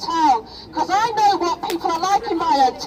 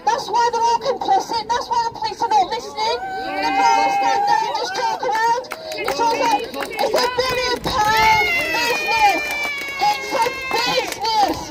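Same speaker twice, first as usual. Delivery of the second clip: impassioned shout; 8 semitones higher than usual. Real, not acted. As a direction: shout it at the crowd in real anger. Angry rally speech about money.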